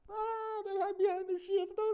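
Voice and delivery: high, loud frightened squeal; hammed-up terror; mock panic. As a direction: panic like a cowering soldier. Acting out the terrified Israelites.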